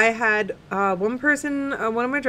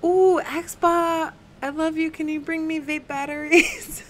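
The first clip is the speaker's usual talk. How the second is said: sweet-talking; 7 semitones higher than usual. Real, not acted. Sweet-talking partner for a favour.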